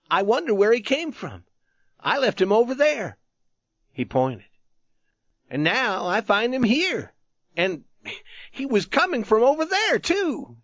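put-on a puzzled young soldier, worked up; youth.

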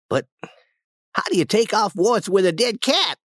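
put-on high-pitched boy's voice, chanting the charm; eager boy, Tom. Tom talking wart cures with Huck, high eager voice. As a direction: answer knowingly up high, then ask eagerly.

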